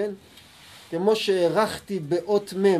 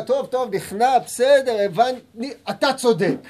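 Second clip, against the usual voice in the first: loud, higher, hurried surrender under shouting; cowed giving in; 5 semitones higher, 5 dB louder than usual. Retold or imitated. Student yelled at by a bully, surrendering loudly. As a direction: give in fast to someone yelling at you. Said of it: "צועק עליך".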